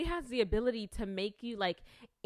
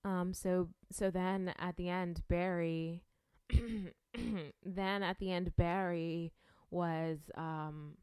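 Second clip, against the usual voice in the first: keeps the lower, calmer tone going; carrying on in a trial low voice, half unsure; put-on calm voice; 5 semitones lower than usual. Shown as a demonstration. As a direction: hold the new low voice as you keep recapping. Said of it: "do I even talk like that?".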